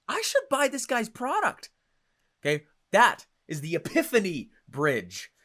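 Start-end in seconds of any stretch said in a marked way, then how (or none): 0.1-1.7 inner realization. Retold or imitated. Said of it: "cause the epiphany in their head".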